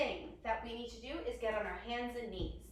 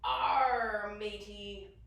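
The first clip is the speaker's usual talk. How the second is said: loud long arrr, then dropping lower; pirate; 4 semitones lower, 5 dB louder, much slower than usual. Pretend. Teacher leading children in plank, loud pirate call. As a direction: hail the mateys like a pirate. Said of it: "Can you all say".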